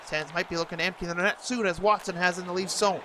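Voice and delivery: ordinary delivery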